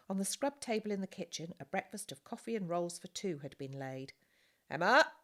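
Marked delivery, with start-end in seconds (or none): 4.7-5.1 loud, high call across the house. A narrator's reading. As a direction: call her from another room. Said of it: "called the farmer".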